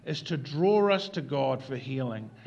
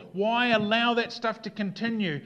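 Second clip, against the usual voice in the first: normal talking voice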